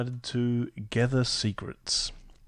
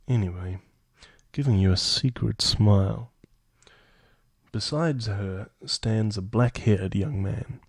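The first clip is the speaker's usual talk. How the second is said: louder storybook narration; story narration; 6 dB louder than usual. Acted. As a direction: narrate the scene aloud. Reads event text aloud.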